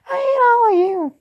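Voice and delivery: put-on high-pitched girlish yell, loud. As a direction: scream it in a shrill girl voice. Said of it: "You expect me to do a fucking girl voice".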